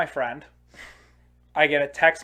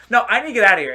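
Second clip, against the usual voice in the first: imitating an angry outburst; 6 semitones higher, 8 dB louder, much faster than usual. Brother storming off angrily.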